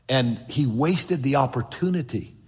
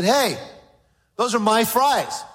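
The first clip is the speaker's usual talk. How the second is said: put-on loud, higher indignant kid's protest; a boy guarding his food, louder and higher; eight-year-old boy; 5 semitones higher, 3 dB louder than usual. Son protesting to his father, indignant and loud. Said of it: "About an eight-year-old boy".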